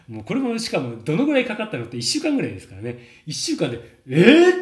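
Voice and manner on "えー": much higher, much louder "えー!"; amazed cry. Retold or imitated. Coach reenacting his shock at the student's voice, loud amazed cry. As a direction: gasp it out in big astonishment.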